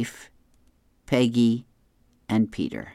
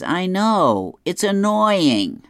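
high, loud, slow grumble; a hammed-up annoyed grumble; Dagwood agreeing; 8 semitones higher, 6 dB louder, much slower than usual. Dagwood agreeing with Blondie about the news, loud annoyed grumble. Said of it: "Dagwood says".

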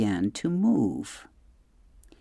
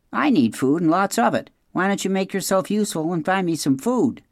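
higher-pitched, bossy fox voice; demanding fox; 7 semitones higher, faster than usual. A narrator's reading. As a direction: order the robot about as a hungry fox.

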